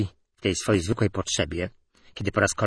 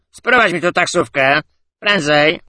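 put-on loud, higher drunken order; drunken demand; 8 semitones higher, 8 dB louder than usual. A narrator's reading. Tipsy man ordering a taxi, loud and slurred. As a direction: bark it out, drunk and bossy. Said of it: "zabełkotał".

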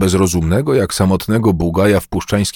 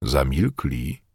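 ordinary delivery, nothing marked

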